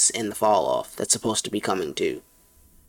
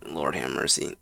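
exasperated; 9 semitones lower, 7 dB quieter than usual. Real, not acted. Exasperated groan at jokes.

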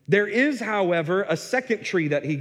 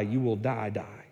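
die twice, trailing off much lower and softer; emphatic doubled die; 8 semitones lower, 6 dB quieter than usual. Shown as a demonstration. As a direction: let the second die fade almost to nothing. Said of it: "You will surely die".

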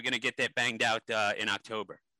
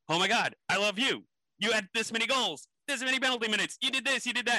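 much higher, breathless fan gush; a fan meeting his hockey hero, gushing much higher; starstruck gushing; 11 semitones higher, faster than usual. Retold or imitated. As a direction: squeal it out like meeting your hero. Meets the hockey-star father.

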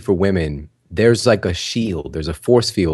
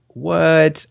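mock disbelief; 8 semitones higher than usual. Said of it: "She's like".

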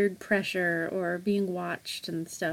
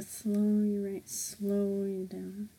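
slowed-down demo; 8 dB quieter, slower than usual. Shown as a demonstration. Drags out the word slow.

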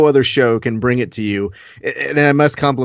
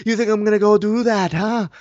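higher mocking fighter voice, put on; mock Conor; 6 semitones higher than usual. Boss mimicking his fighter in a higher mocking voice. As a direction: whine it back like a cocky fighter.

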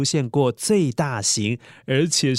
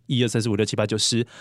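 fast counting; 6 semitones lower, much faster than usual. Acted. Counting to ten quickly.

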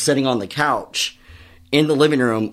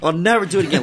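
mock distressed wail; 6 semitones higher, faster than usual. Voices brother-in-law on bad trip.

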